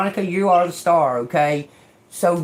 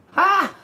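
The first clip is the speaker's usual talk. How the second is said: scornful laugh; 11 semitones higher than usual. Real, not acted. Mocking the commenter.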